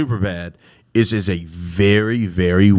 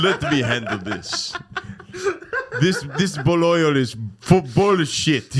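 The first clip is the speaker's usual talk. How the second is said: slow, higher-pitched broken-English bit; 7 semitones higher, slower than usual. Acted. Comic playing a foreigner taking charge, broken English. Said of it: "when I break my English".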